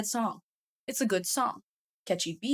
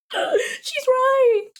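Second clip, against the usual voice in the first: a sharp gasp, then a high, loud, slow cry of agreement; gasping shock; 8 dB louder than usual. Real, not acted. Reacting to [name]'s reply to a fan, gasping in shocked agreement. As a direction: stretch it out like she can't believe it.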